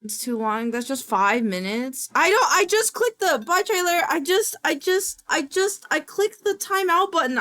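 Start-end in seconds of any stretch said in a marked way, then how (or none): 2.1-7.4 high, stumbling self-defence; flustered pleading. Real, not acted. Streamer explaining the mistaken click, flustered. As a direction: plead innocence in a raised voice. Flustered excuse to timed-out viewer.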